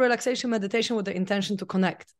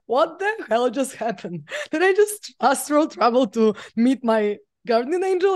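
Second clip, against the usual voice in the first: retold shock; 3 semitones higher than usual. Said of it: "I was so shocked".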